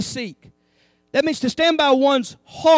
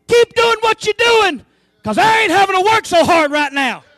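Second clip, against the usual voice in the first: a loud, higher mock cheer from the back of the flock; geese in the back cheering; 5 semitones higher, 7 dB louder than usual. A goose at the back cheering the leader on, loud and higher. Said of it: "They're saying".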